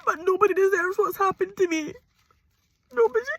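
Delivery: high, loud, breaking sobs; furious tears about the idiot she stayed with; angry crying. Real, not acted. Tearful anger at ex-partner.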